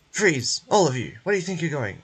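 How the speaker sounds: sudden loud bark to halt them; shouted command. Acted. Guard ordering the fugitives to stop, shouting. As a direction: stop everyone cold with a yell. Guard catching the group escaping.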